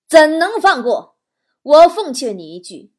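very loud, deliberate villain's warning; menacing threat. Acted. Driver refuses his passenger mercy, loudly menacing. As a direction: hit it hard, stretching the first words.

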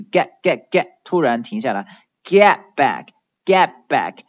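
"get" cut off suddenly, T unreleased; abrupt stop. Shown as a demonstration. Teacher drilling the first word, abruptly stopped "get". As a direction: stop dead at the end of "get" without sounding the T.

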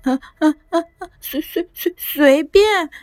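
high, halting, frightened stutter; scared boy answering the monster; scared stammer. A narrator's reading. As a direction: stumble over the words in fright. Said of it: "萝卜吓坏了".

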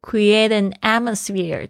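slow "create an atmosphere", words run together; linked sounds. Shown as a demonstration. Teacher letting learners hear the liaison, linked sounds. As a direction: glide from "an" straight into "atmosphere", slowly. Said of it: "好像有一个连音".

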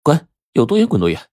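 high, cross dismissal; angry yelling. A narrator's reading. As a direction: send him packing, a mild yell. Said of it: "一边嚷道".

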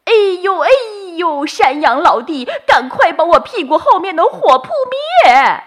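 high, loud pained monkey cry; yelling in pain. Acted. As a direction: howl for help, tail on fire. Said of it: "疼得猴子哇哇大叫起来".

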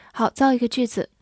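normal talking voice

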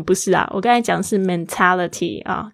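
normal talking voice

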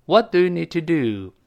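"What do you need to do？" with What stressed and a clear fall at the end; falling tune on the question. Shown as a demonstration. Teacher modelling the falling tune of a wh-question. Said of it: "别忘记降调啊".

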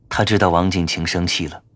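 ordinary delivery, nothing marked

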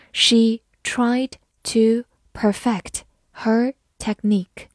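slow, separated model reading. Shown as a demonstration. Teacher reading the technique sentence for learners, slowly. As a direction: read slowly, one word at a time. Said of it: "好，慢读一遍".

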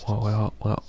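ordinary talk

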